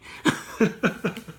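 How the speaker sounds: high giggling burst of laughter; amused laugh. Real, not acted. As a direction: laugh lightly at your own remark. Amused at her own remark.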